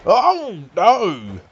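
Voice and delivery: high, loud gasp of disbelief; shocked outcry. Acted. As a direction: yelp it out in shock. Shocked reaction to a move.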